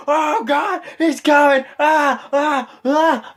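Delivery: high, loud mock screams of an excited crowd; crowd popping. Everybody pops as Triple H comes out, mock screaming. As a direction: imitate fans shrieking at a big entrance. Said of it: "Everybody pops like".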